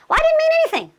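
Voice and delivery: very high mock whine; mock a defensive whiner, way up high; squeaky put-on protest; whiny excuse. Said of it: "you get a little whine in your voice".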